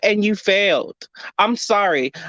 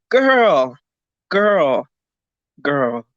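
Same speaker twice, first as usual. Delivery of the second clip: exasperated, fading; 6 semitones lower, much slower than usual. Real, not acted.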